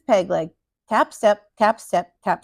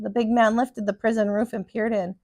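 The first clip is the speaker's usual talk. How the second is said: ordinary delivery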